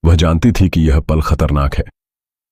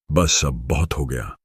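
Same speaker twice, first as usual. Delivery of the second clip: slightly lowered, softer voice; spoken softly; 2 semitones lower, 7 dB quieter than usual. A narrator's reading. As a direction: say it quietly, with restraint. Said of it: "उसने धीरे से कहा". (धीमी, दबी आवाज़ में)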